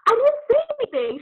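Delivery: high, loud mock-innocent protest; indignant protest. Child defending herself to mom, indignant.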